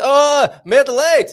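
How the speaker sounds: loud, high, drawn-out "Oh" of dawning understanding; sudden recognition. Retold or imitated. Locals finally understanding the foreigner's coffee order, exaggerated loud realisation. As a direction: mimic locals finally getting it, slow and exaggerated. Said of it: "So, and they say".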